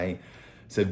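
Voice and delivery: ordinary talk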